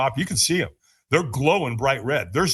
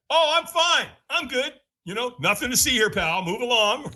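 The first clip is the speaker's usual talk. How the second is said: high-pitched mock-breezy denial; lying, fake fine; 10 semitones higher than usual. Man lies to a stranger about not being okay, fake breeziness. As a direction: brush it off far too lightly. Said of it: "We lie about it".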